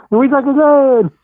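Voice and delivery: high, drawn-out comic cry; hammed-up film-quote impression; Rob Schneider. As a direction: stretch it out in a put-on movie accent. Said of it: "you gotta do it with the accent".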